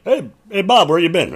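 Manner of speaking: calling out, high and loud; loud greeting. Retold or imitated. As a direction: call out across the room. Acted greeting to Bob.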